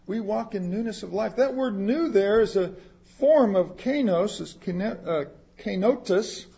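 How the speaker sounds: usual speaking voice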